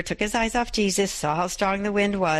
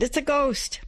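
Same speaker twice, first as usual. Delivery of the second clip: frightened cry; 9 semitones higher than usual. Acted. Disciples cry out in fear.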